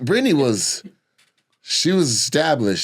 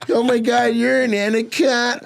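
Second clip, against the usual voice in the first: put-on Valley girl voice; 11 semitones higher than usual. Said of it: "he'd be like".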